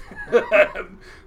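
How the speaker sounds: a loud burst of laughter. Real, not acted. Laughs after buddies' line.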